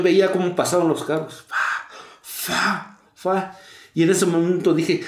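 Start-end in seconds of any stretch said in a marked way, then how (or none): 1.5-3.6 soft breathy whooshes of cars going by; imitated passing cars. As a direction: mimic traffic rushing past. (imitando el zumbido de los carros)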